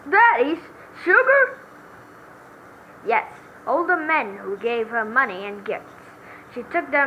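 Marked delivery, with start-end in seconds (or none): 0.1-1.6 very high, loud question; confused outburst; baffled squeal. Acted. Witness confused shout.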